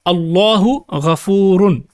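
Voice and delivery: slow, careful Arabic reading of Allahu ghafurun; Arabic recitation. Shown as a demonstration. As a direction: read Allahu ghafurun slowly, each syllable clear. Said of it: "a standard jumla ismiya here on the screen".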